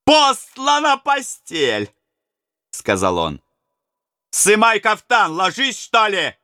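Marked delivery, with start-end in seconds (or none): 0.0-1.9 high, slow jeering robber call; mocking robber. Robber jeers at the bound Maxim, high and drawn out. 4.3-6.4 rough robber order. Acted. Robber orders captive to lie.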